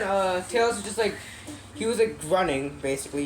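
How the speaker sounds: usual speaking voice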